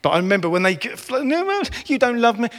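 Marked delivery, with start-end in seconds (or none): 1.2-2.5 high mock child protest; imitating a whiny child. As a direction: squeak it out like a sulking kid.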